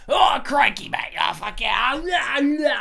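loud, hammed-up Australian bloke voice; mock Australian accent. Mocking the Sharknado actor's Australian accent, loud hammed-up Australian. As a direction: shout it like a cartoon Aussie from a bad movie. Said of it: "he sounds like this".

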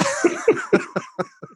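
breaking into a high laugh. Real, not acted. Host cracking up after his own silly accent, laughing. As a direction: laugh at your own performance.

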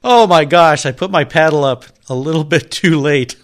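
loud delighted laughter; real laugh bursting through the words. Real, not acted. Host delighted by auction demo.

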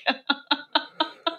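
high, light giggling; laughter. Real, not acted. Amused by the quote about her, high giggle. Laughs after quoting own nickname.

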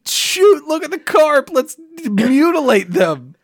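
high, hammed-up goofy kid; mock kid voice. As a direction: play a dumb excited kid. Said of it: "we were not like".

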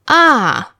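ah falling steeply from high; "ah" starting high, plunging down. Shown as a demonstration. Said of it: "the falling tone".

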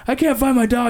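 high, pleading voice imitating the neighbor; distressed whine. Neighbor telling dad about his dog, high mock wail. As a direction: play the neighbor, all in a high upset wail. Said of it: "And he goes".

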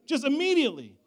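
loud, high, impassioned preaching; fiery pulpit testimony, slowed on key words; full-force preaching. Acted. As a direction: preach it out to the whole room.

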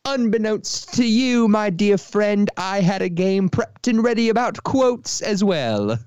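high, slow, hammed-up Cockney-ish voice; theatrical mock-Cockney announcement; put-on Cockney-like accent. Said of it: "talk like this".

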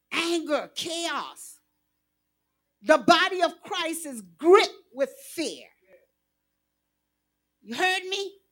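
usual speaking voice

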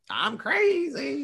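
high, slow mocking madman; drawn-out hammed-up crazy line; mock villain. Podcaster mocking Tetsuo's villain turn, high and slow. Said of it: "And he's like".

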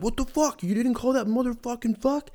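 shrill high squeal of a furious fan; cartoonish high-pitched fan complaint; mock outraged fan. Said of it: "Chiefs fans would be like".

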